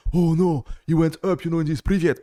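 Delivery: mock-horrified coach, high and loud; mock dating coach. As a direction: ham up a scandalized dating guru. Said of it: "dating culture will be like".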